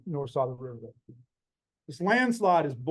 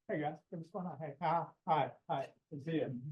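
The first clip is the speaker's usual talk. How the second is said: softer, light friendly greeting as his colleague; lightly voiced cheery professor saying hi round the group; Joey Shaw impression; 9 dB quieter than usual. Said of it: "it's a very good Joey Shaw impression".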